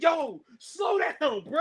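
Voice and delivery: high, slow, laughing yell of panic; laughing scream. Retold or imitated. Riding in the car, begging the driver to slow down, laughing shout. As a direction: shriek it like a scared kid on a wild ride. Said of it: "two little kids screaming".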